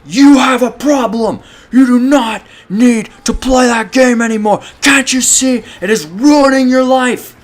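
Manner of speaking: stern dad. Retold or imitated. Dad grabbing him, lecturing.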